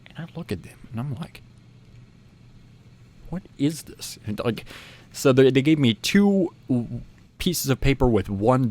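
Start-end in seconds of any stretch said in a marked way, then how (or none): 3.3-4.2 higher-pitched puzzled question; retold bafflement. Teen recalls staring at odd paper tickets, baffled. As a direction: puzzle over it, a little softer.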